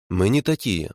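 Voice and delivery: ordinary delivery, nothing marked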